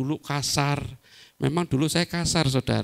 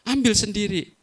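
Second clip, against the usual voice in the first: short snappy retort pitched higher; curt wife; 8 semitones higher, 3 dB louder than usual. Acted. Wife telling husband to fetch it himself, curt and high. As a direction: snap it back like a fed-up wife.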